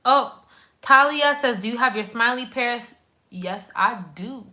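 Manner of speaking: ordinary talk, nothing marked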